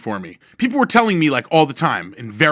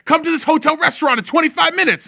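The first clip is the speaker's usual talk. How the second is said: much higher and louder, commanding; 13 semitones higher, 4 dB louder than usual. Retold or imitated. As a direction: order him over like a star. [name]'s text.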